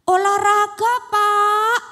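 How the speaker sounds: put-on loud, high chorus of kids; in unison. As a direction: shout it together like a class. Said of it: "kata teman-teman Dina serempak".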